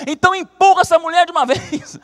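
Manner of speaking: high, loud yell breaking into a laugh; impatient yell. Acted. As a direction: lose patience and shout, laughing. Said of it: "você lá atrás perde a paciência".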